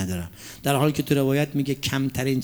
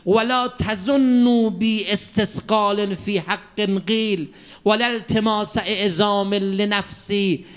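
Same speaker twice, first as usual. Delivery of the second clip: slow, raised chanting of scripture; preaching recitation; 6 semitones higher than usual. Acted. Preacher reciting Imam Ali's words to the congregation, chanted. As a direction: proclaim the Arabic like a pulpit chant. Sermon recitation of Nahj al-Balagha.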